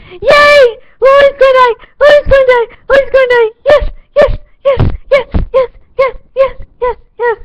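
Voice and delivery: shrieking high and loud with glee; gleeful shouting. Retold or imitated. Fan hoping Lori dies, shrieking gleeful cheers. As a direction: scream for joy at the screen. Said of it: "and I'm like".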